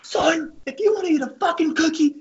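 very high, strained mock coach yell; hammed-up angry coach impression; Coach Garrido. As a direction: imitate a coach banishing a player, voice way up. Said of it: "He goes".

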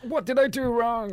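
high, slow put-on wail; mock distraught woman. Comic voicing women left wondering, high mock distress. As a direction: imitate a partner fretting over herself.

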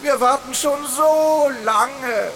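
a bad imitation reaching out; poor copy of a voice. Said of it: "Was für eine miese Kopie". (schlechte, langsame Stimmkopie)

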